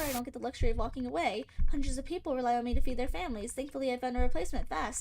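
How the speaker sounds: fast, loud rant read; brisk loud rant read; boss rant read-out. Acted. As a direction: rattle off the rich boss's rant. Reads a boss character's rant.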